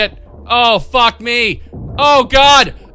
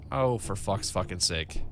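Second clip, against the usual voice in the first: exasperated mutter; 15 dB quieter than usual. Real, not acted.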